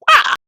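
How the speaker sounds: short loud startled cry of wah; loud wah exclaim. Real, not acted. Reacting to the episode, a loud wah. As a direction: blurt a sudden wah. Shocked outburst.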